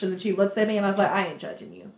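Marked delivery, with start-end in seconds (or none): 1.1-1.9 soft, lower, fading to a whisper; quiet reassurance meant only for her; whispered have a good time; hushed aside. Retold or imitated. Discreet word to a customer.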